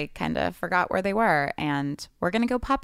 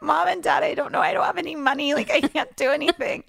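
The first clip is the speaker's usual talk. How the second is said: whiny plea; 10 semitones higher, 3 dB louder than usual. Retold or imitated. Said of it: "I'd be like".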